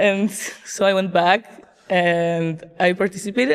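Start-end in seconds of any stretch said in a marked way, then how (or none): none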